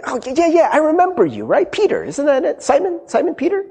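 put-on high, teasing servant-girl voice; servant girl accusing. Servant girl at the fire needling Peter, high and teasing. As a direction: poke at him like a nosy girl by the fire. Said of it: "they're like".

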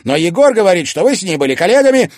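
high, very loud, heated accusation; accusing shout. A narrator's reading. Son listing his mother's lies, shouting. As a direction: fire the lies back at full voice.

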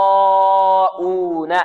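"يراءون" with the raa held very long on a level note; long madd. Shown as a demonstration. Teacher demonstrating the madd, long sustained vowel. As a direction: sustain the aa of "يراءون" before the hamza. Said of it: "لماذا مددنا الألف".

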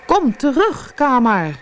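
high-pitched alarmed call; father crying after his departing son. A narrator's reading. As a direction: call into the distance, urgently but not roaring. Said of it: "riep hij".

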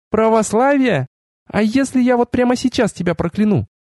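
high female voice, threatening; outraged goddess. A narrator's reading. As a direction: threaten him in a high, indignant voice. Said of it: "взвыла Ашарис". (разгневанная богиня, высоко и возмущённо)

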